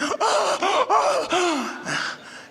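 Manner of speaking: a high, startled gasp, hamming up the guards' awe; mock shocked gasp. Mimicking the awestruck guards with a high gasp. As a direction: gasp like a guard who has just seen Ali walk in. Guards shrink as Ali enters.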